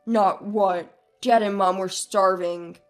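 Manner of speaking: low, loud rebuke; angry put-on husband voice; furious husband. A narrator's reading. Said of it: "with a furious expression".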